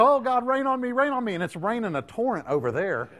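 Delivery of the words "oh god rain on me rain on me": loud, high, pleading mock prayer; mock begging prayer. Preacher imitating the church begging God for rain, loud. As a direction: beg for rain at full voice. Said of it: "We want it to".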